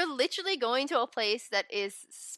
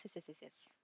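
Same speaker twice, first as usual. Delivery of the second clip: whispered; 4 semitones lower, 24 dB quieter than usual. Real, not acted. Trailing agreement.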